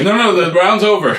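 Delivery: half-laughing as the protesting boxer. Retold or imitated. Boxer protesting after the bell.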